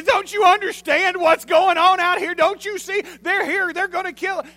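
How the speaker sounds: very high, loud, hammed-up panic; freaking out. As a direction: shout it like a hysterical servant. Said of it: "he's freaking out".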